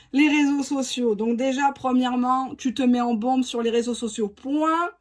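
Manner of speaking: ordinary talk, nothing marked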